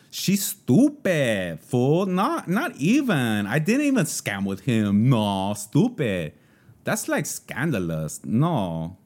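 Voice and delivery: put-on drawn-out young female Valley Girl with swooping pitch. Shown as a demonstration. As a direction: do a young San Fernando Valley Girl, stretching the words. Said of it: "That's a Valley Girl".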